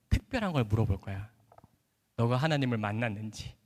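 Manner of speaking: low, soft motherly voice with measured pauses; gently teaching mother. Retold or imitated. Mother coaching her son for the university interview, gentle and low. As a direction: voice a mother tenderly explaining. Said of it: "저희 어머니가 얼마나 즐겁게 저를 가르쳐줬는지".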